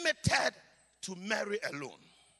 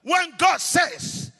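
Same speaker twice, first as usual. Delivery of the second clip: loud, high, chanting sermon climax; preaching at full force; 6 semitones higher, 14 dB louder than usual. Acted. Preacher calling on women and choir, preaching at full force. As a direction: rouse the church into a fervent blessing.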